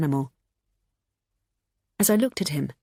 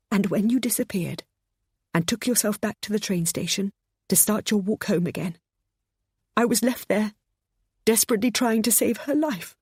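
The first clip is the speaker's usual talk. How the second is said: higher, tearful, voice close to breaking; 5 semitones higher than usual. A narrator's reading. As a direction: hold back sobs. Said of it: "Her voice broke as she sobbed".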